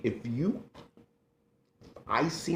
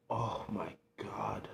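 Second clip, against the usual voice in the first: a choked, tearful whisper; breaking up over her Lola; real tears behind a soft whisper; 12 dB quieter, slower than usual. Real, not acted. Missing late grandmother.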